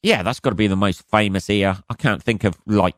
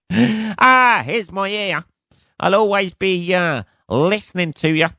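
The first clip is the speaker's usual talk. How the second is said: mock Van Gogh; 10 semitones higher, slower than usual. Van Gogh giving his ear.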